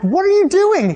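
high, loud exasperated question; hammed-up disbelieving shout; incredulous yell. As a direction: holler it at someone acting crazy. Retold exasperation at dad in storm.

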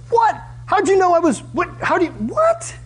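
high, squeaky baffled protest; high-pitched flustered denial; confused scribes. Acted. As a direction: play the flustered scribe caught out. Scribes' confused retort, voiced.

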